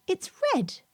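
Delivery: put-on high, curious child voice; a small girl pointing it out; little girl. A narrator's reading. Lucy telling the washerwoman the hankie is red. Lucy noticing a stranger's handkerchief.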